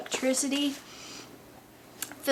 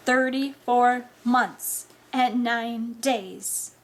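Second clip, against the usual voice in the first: slow, deliberate, precise count; exact pronouncement; 3 semitones higher, 3 dB louder, much slower than usual. Acted. Friend answering mother with an exact tally, deliberate. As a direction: spell out the figure slowly and firmly. Austin blurts exact figure.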